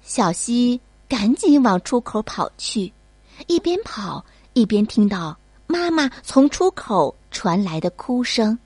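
ordinary delivery

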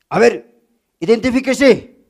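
stern cop demanding ID; a mock stern policeman; police officer. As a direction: bark it like a traffic cop. Comedian voices police stopping someone.

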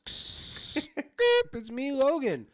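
very high, loud muffled bubble voice. Pretend. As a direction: squeal as if trapped in a bubble. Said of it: "Is that me in, like, in a bubble?".

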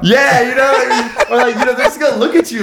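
high-pitched, laugh-filled explanation; laughing while talking. Real, not acted. Defending his fear of buying condoms, laughing. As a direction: talk through laughter, voice riding high. Laughing at friend's jail joke.

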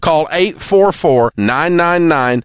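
slow, warm ad read; warm announcer. Acted. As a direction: sell it like a trusted radio host. Recorded timeshare ad read.

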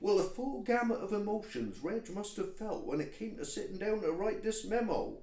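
high, theatrical West Country narration; resume the tale with dramatic flourish; scene-setting storyteller. Acted. [name] imagines Reg writing the memo, theatrical storytelling. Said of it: "setting the scene".

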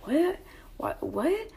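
high, rising whats; imitated clueless questioning. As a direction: mimic someone playing innocent. Man acts clueless when confronted.

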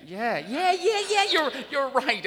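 eager, high and rising yeses; excited relief. Acted. Customer thrilled the parts man finally knows his car, high eager yeses. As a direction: bubble over with relief.

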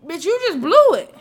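loud, high exasperated outburst. Real, not acted. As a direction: blurt it out loud and fed up. Rant about fiancée on TV.

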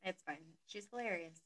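ordinary delivery

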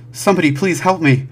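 loud, high panicked shout; desperate plea. Acted. Man collapsing in police station, desperate shouted plea. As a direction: beg loudly for anyone's help. Man bursts in begging for help.

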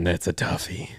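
soft whispered aside; whispering. Real, not acted. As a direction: whisper it to yourself, stumped. Same words, now whispered.

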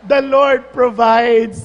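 slow, loud, laughing proclamation; triumphant hunter proclaiming, laughing; laughing punchline. Retold or imitated. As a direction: shout the punchline through laughter.

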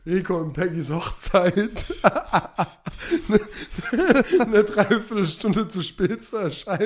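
laughing through the words; giggling while speaking. Real, not acted. Laughing at own lateness.

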